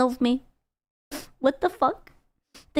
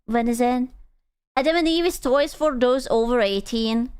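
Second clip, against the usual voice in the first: ordinary talk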